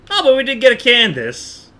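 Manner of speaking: usual speaking voice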